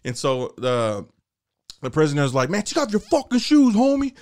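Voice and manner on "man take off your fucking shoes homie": inmate bully. Retold or imitated.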